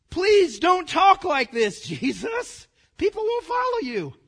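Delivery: high-pitched mock plea to Jesus; hammed-up, high pleading; pleading disciples. Acts out astonished disciples' plea.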